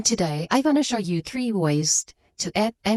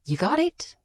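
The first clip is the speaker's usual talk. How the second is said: ordinary talk, nothing marked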